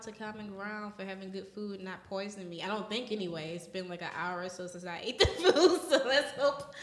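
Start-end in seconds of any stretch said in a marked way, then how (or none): 5.0-6.7 chuckling through the words; laughing. Real, not acted. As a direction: laugh while you talk. Laughs while joking about food.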